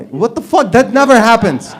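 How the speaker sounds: mock-outraged Louvre rant; exasperated. Real, not acted. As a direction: blow up in disbelief. Comic's outraged punchline rant.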